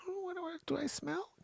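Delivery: lightly voiced high, quiet fretting; mock self-conscious woman. Acts out Joanne's worried thought.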